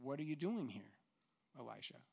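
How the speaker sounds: low, soft voice of God; soft gentle question. Acted. God asking Elijah in the cave, soft and low. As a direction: let it fall gently. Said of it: "this is what God says".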